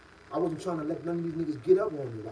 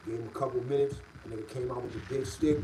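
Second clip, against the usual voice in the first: ordinary talk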